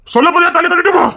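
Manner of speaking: high, loud furious demand; angry shout. Acted. Man losing patience with the woman, shouting. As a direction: blow up and yell the threat. Man pressing her to answer.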